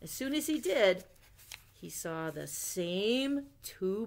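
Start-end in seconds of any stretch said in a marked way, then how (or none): none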